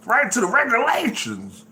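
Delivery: high, soaring preacher delivery. Acted. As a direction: proclaim it from the pulpit. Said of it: "I did that in my preacher voice".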